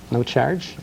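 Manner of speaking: ordinary delivery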